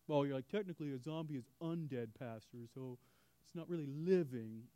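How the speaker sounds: be the know-it-all in the pew; nitpicking listener. Pretend. Listener correcting the pastor on zombies, pedantic. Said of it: "That would be a".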